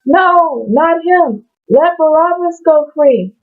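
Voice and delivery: high, hammed-up crowd yell of refusal; crowd shouting back. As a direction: cry out as the mob rejecting him. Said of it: "They shouted back".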